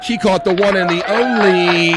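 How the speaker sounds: drawn-out game-show intro voice; announcer build-up. Real, not acted. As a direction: stretch it out like a ring announcer. Host builds up to the soundbite.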